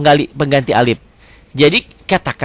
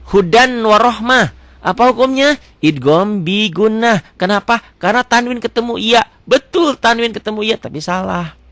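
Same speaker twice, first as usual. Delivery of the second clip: higher, confident mock pupil; mock student answer; 9 semitones higher than usual. Teacher acts out a student who gives the wrong rule. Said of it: "Kok salah?".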